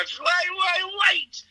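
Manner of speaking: very high, soft wait wait wait; frantic stop to the band; mock panic. Comedian stopping his band, high squeaky wait. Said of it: "I go".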